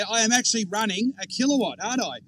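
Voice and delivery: normal talking voice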